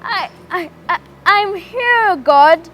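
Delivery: high, halting frightened stammer; stammering fear. Acted. Adam answers God, high and stammering in fear. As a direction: caught and scared, tripping on words.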